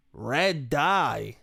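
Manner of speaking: slow, drawn-out condescending query; asshole intonation. Retold or imitated. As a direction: repeat it back like a condescending asshole. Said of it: "in that asshole, shitty fucking intonation, that fucking awful tone".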